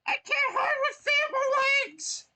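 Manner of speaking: very high, loud wailing cry; trapped Toad wailing high and loud. Acted. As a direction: wail it like a trapped little mushroom.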